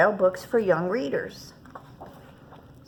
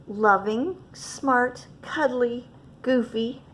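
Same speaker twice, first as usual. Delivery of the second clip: usual speaking voice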